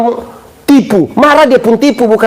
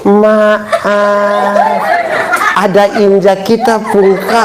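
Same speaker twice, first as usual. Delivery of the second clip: mock timid apology; much slower than usual. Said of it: "Bukan berarti bilang".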